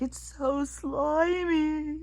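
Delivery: high, slow, whiny "so slimy"; disgusted whine. Retold or imitated. Tourist whining about the seal, high and slow. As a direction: drag it out in disgust. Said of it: "she's like".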